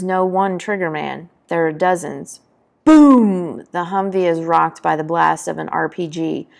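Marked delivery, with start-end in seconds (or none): 2.9-3.6 big, loud, higher BOOM; booming imitation of an RPG hit; explosion noise. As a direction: burst out with the blast.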